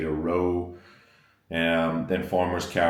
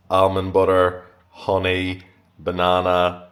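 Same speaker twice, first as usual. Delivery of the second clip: usual speaking voice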